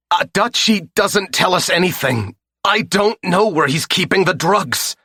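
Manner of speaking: high, loud, desperate pleading; bloodied captive pleading with his captor, high and loud; panicked captive. Acted. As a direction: beg for your life in a high frantic voice. Beaten captive pleading at gunpoint.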